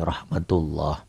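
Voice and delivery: rahmatullah soft and slow, rounded "lloh". Shown as a demonstration. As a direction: round rahmatullah at the end as if a W were in it. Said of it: "Seakan-akan ini ada W-nya".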